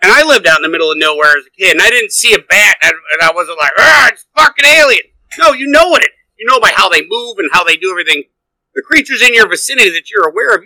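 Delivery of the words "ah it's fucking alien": high startled yelp; mock alarmed shout. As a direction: blurt it out like you've seen an alien. Mock panicked reaction to bat.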